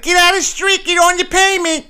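high, loud mock Northeast accent yelling "payment"; Northeast accent impression. Philly local yelling at someone in the street, Northeast accent. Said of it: "a thick Northeast accent".

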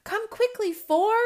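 high, loud hurried call; urgent cry. Acted. Owlet calls smallest sibling to hurry, crying out. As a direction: call out over the wind. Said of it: "cried one".

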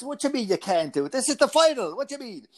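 fast, high-pitched exasperated Irish dad; Irish accent rising into high-pitched protest. Retold or imitated. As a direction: imitate an Irish father's disbelief. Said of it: "that's my Irish accent".

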